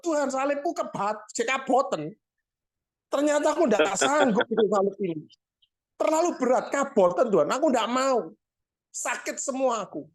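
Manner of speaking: high, pleading whine; man begs God for a lighter cross, whining; whiny complaining man. Acted. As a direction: beg God to swap the cross.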